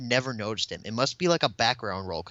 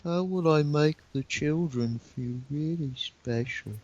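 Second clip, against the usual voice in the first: slightly higher, drawn-out narrator voice; Michael Angelis impression; 5 semitones higher, slower than usual. Podcaster showing how another narrator sounds, slow imitation. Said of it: "Michael Angelis is more like".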